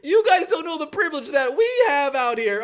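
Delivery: mock Becky screaming. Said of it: "Becky Hammon screaming".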